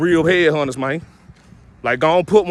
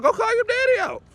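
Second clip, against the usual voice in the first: much higher, mocking falsetto. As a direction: squeak it out as a taunt. Mocking the advice, high voice.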